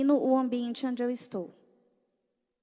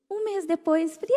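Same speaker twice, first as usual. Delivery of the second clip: normal talking voice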